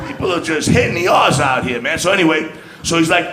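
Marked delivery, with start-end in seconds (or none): none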